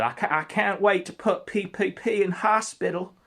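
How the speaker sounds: high, loud hammed-up threat; menacing Ralph. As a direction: boast about hurting him in a put-on voice.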